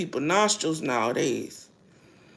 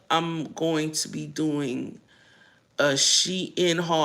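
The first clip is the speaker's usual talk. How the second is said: ordinary delivery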